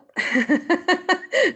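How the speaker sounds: high, loud burst of laughter; amused laughter. Real, not acted. Laughing at what Raymond just said, high loud laughter. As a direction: laugh out loud in a rapid cackle.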